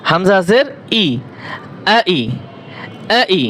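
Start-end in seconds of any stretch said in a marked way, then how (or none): 2.0-3.4 আ ই as short quick syllables, no stretch; quick short vowels. Shown as a demonstration. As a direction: clip আ and ই short and fast, no lengthening. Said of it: "এরকম করে তাড়াতাড়ি করবেন, টান দেওয়া যাবে না".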